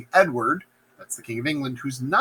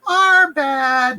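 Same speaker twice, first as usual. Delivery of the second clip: high, loud witchy apology; comic witch voice; 11 semitones higher, 7 dB louder than usual. Acted. Witches apologising to their boss, high comic witch voice. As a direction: squawk it as sheepish witches.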